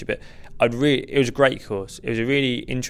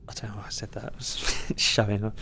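whispered aside; 8 dB quieter than usual. Real, not acted. Embarrassed aside after boast.

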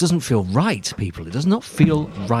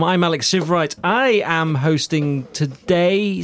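ordinary talk

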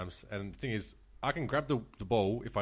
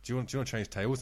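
normal talking voice

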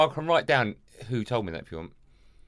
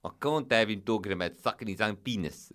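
mimicked South African agent, firm refusal; South African accent. Agent protecting his client, South African accent. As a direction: open with emphatic South African noes, then settle. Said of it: "This is what he said".

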